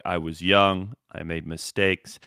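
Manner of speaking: low, subdued non-apology; mock contrite. Actor dodging an apology, mock contrite. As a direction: imitate someone soberly excusing his past. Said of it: "he does everything except apologize".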